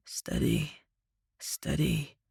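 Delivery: hushed whisper to himself. Acted. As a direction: breathe the words out, barely voiced. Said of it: "he told himself".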